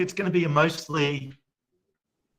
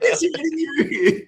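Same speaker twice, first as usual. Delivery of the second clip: much higher, laughing mock surprise; mock Paddy greeting; 12 semitones higher, 4 dB louder than usual. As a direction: ham up a fake surprise meeting. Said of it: "he turns up and he goes".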